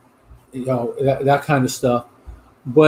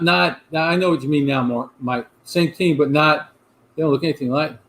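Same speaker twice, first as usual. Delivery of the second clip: ordinary delivery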